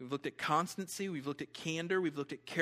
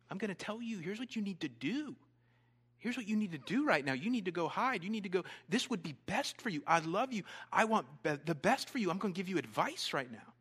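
higher-pitched, insistent friendly advice; earnest urging; 5 semitones higher, 4 dB louder than usual. Acted. Jonathan urging his friend David to hide, earnest and insistent.

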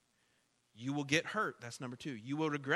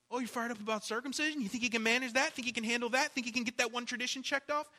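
sarcastic challenge; 6 semitones higher, much faster than usual. Acted. Preacher mocking legalists.

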